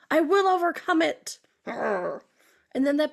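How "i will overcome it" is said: higher, louder mock vow; play-acted fierce resolve; mock determination. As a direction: declare it like a battle cry, hammed up. Acting out a determined overcomer.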